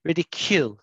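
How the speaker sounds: "Ridicule" said RID-i-kyool with the kyoo clear; teacher's corrected "Ridicule", kyool not cool; correct pronunciation. Shown as a demonstration. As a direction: model the fixed "Ridicule" for the student. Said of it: "See that?".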